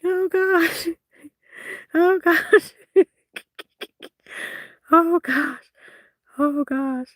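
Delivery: high, breathless with laughter; laughing. Real, not acted. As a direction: gasp it out while still laughing hard. Still cracking up.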